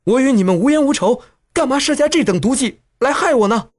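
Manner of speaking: high-pitched, indignant accusation mid-fight; angry scolding. A narrator's reading. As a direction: scold them in a high, aggrieved voice. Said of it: "一面打一面骂道".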